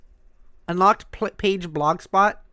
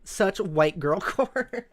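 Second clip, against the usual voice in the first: ordinary talk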